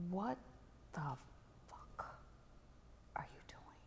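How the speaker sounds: a hushed whispered rebuke; hushed demand. Retold or imitated. Owner confronts her in ihop.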